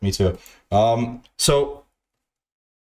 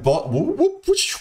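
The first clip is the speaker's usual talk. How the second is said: high whooping sound effects; imitated whoop and whoosh; 14 semitones higher, slower than usual. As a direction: whoop up into a whoosh, miming the action. Miming food being played with.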